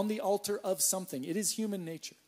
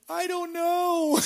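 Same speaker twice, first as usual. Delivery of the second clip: high, slow, drawn-out whimper of cluelessness; sheepish helplessness; 12 semitones higher, 8 dB louder, much slower than usual. Retold or imitated. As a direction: play it utterly lost and a little pathetic. Helpless answer from scaffolding.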